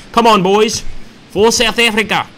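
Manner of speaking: rally the troops in a mock South African accent. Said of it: "I'm doing my best South African accent".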